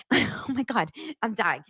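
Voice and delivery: ordinary delivery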